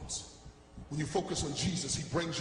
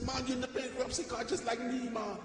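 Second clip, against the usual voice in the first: put-on higher Jamaican-accented voice; 5 semitones higher than usual. Jamaican brother greeting a fellow bankrupt, Jamaican accent. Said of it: "a Jamaican brother".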